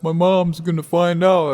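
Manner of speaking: high, loud imitation of a worried friend; mock panic. Friend frets about his mom, mock panic. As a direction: wail it in mockery.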